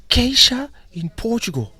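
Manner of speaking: high, loud, slow exclamation; imitated astonished fan; acted starstruck amazement. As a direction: call out the star's name in disbelief. Acting the man recognizing him.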